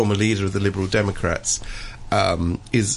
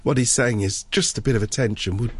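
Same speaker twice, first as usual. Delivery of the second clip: ordinary delivery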